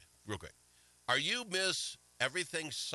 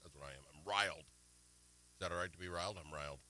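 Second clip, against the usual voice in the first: mock riled muttering; 9 semitones lower, 8 dB quieter, faster than usual. Real, not acted. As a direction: lower and much softer, oddly subdued. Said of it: "I'm mad".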